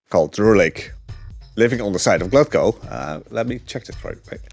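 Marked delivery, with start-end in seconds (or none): none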